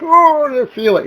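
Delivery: sudden high yelp of sympathy pain, then easing; mock pained wince. Watching someone get kicked, a mock pained yelp. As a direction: yelp as if you felt the kick yourself. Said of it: "you see somebody get kicked in the hoo-haws and you're like".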